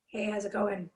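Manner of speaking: low raspy greeting. Shown as a demonstration. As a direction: let the rasp show, low. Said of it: "it's really raspy. Do you hear it?".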